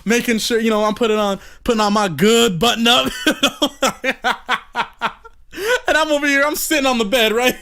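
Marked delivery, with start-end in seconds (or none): 3.2-5.1 laughing. Real, not acted. Laughing at himself.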